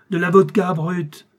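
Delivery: loud, high, slow angry command; barked order. A narrator's reading. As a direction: bellow for a drink. General bellows order at servant.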